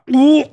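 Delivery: high rising retching whoa; a heave that slips out as whoa; mock retch whoa. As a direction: gag as you say it. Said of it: "I'm like".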